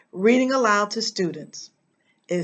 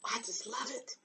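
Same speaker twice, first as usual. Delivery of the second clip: whisper; 20 dB quieter than usual. Real, not acted. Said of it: "whispered aside after song".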